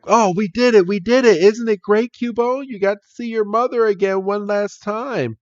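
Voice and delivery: high, excited cheering; gleeful celebration. Acted. Friends to Cubone after winning, gleeful.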